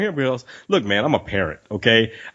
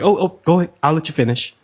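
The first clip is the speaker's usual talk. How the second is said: higher-pitched, hasty deference; mock backing off; 5 semitones higher than usual. Bystander yielding to a lifter, mock deference pitched up. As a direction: imitate someone retreating apologetically.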